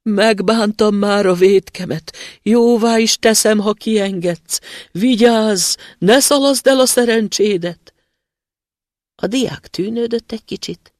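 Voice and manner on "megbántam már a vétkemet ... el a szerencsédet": loud, high begging, slow at first; pleading spirit. Acted. Trapped spirit begs the student to free him: high pleading. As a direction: plead desperately from the bottle.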